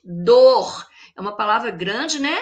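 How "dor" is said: "Dor" alone, firmly stressed; strong syllable. Shown as a demonstration. Teacher answering which syllable is strong, "Dor" stressed. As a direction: hold "Dor" as one weighty beat. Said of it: "Qual que foi a sílaba forte".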